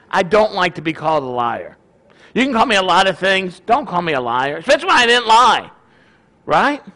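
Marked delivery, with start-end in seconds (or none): none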